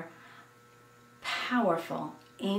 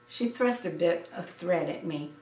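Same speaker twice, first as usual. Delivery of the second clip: ordinary delivery, nothing marked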